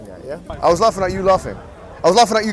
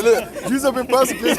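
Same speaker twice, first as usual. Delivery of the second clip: slowed-down line breaking into laughter; laughing mock quote; 5 dB quieter than usual. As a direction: imitate the other side, cracking up.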